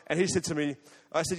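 ordinary talk

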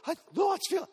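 lightly voiced girl stumbling over her words; mock surprised girl. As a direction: act out a girl amazed her leg feels better. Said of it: "She goes".

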